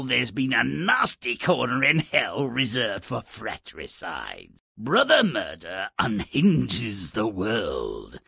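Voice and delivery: normal talking voice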